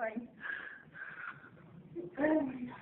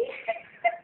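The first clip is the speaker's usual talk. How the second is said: teary laugh; 9 semitones higher, 6 dB quieter than usual. Real, not acted. Teary laugh after test.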